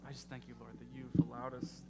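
soft, earnest closing prayer. Acted. Pastor leading the church in prayer, soft and earnest. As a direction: pray quietly and humbly.

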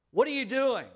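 put-on high, loud parent calling for a child. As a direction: call out like a worried parent. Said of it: "you heard their voice saying".

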